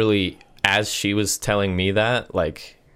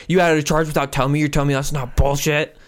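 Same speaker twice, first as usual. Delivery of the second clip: higher, louder, fast mock outrage; imitated yelling; 8 semitones higher, 3 dB louder than usual. Old customer accuses staff of a hidden charge, mock angry. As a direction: rant like an offended old man-baby. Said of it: "he just starts fucking yelling".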